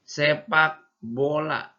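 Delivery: "Sepak bola" slow and drawn out, the "sepak" part clearly stressed; model word. Shown as a demonstration. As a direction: stretch "Sepak bola" and hit "sepak". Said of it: "Jangan bola ya, beda".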